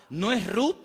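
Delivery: ordinary talk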